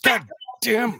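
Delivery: high, anguished groan of regret; mock anguish. Real, not acted. Narrator recalling a whole book with the wrong accent, high anguished groan. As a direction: wail at the mistake. Realising own accent mistake.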